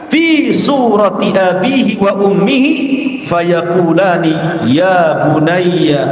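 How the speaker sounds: slow, measured recital with drawn-out syllables; Arabic hadith recitation. Acted. Preacher quoting a long hadith to listeners, solemn Arabic recitation. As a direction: recite it slowly, stretching the long vowels.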